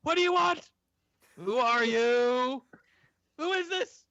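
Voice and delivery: high-pitched frantic demands; a panicked woman crying out at no one, words stretched; screaming at the air. Acted. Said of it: "just screams at the air".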